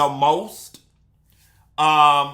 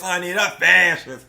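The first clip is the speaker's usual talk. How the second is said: sing-song catchphrase; 5 dB louder than usual. Acted. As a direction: let the audience chant along. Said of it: "How many of y'all said that with me?".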